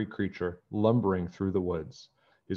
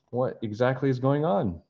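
ordinary delivery

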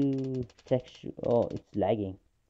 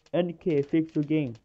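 louder mock complaint from the players; quoting angry players; 5 semitones higher, 4 dB louder than usual. Streamer quoting players' complaint to Ninja Kiwi, louder. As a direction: chant it like a fed-up player. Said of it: "I've been seeing people say".

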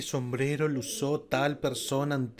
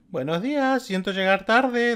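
sheepish schoolgirl; 9 semitones higher, 6 dB louder, slower than usual. Acted. Kyoko entering classroom late.

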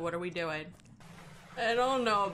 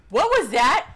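startled; 9 dB louder than usual. Real, not acted. Sudden noise in horror game.

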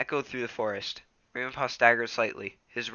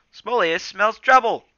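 much higher, louder, slow warning cry; alarmed shout; 11 semitones higher, 11 dB louder, slower than usual. Acted. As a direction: sound the alarm. Graypaw alerts.

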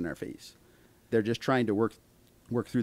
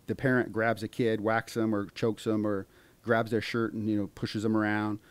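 ordinary talk

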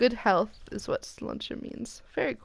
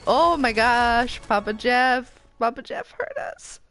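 excited; 4 semitones higher, 8 dB louder than usual. Real, not acted. Legendary skin reaction.

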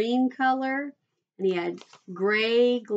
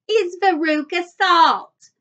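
slow, loud, high announcement; high child's voice, proclaiming; self-important child; 7 semitones higher, 8 dB louder, slower than usual. A narrator's reading. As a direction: proclaim your name, stretching it out. Child announces herself grandly.

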